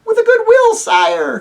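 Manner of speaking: very high, loud squeaky little voices; tiny creatures answering their king, high and shrill; shrill mice. Acted. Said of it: "came the shrill voices".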